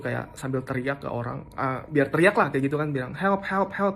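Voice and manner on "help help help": higher, retold cry for help; mock shout for help; yelling for help. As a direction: call out for help, half-acted. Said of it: "gua sambil yelling gitu".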